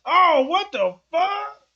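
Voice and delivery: mock shock. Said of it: "don't make me look like".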